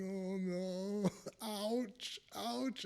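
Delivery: high, thin mock whimper, half laughing; retold whimpering. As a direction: replay your own little whimpers of pain, lightly. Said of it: "laughing like this and then whimpering".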